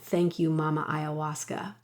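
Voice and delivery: bow your head and murmur it; hushed prayer. Acted. Reads prayerful journal thanks.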